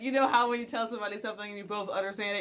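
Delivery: ordinary talk, nothing marked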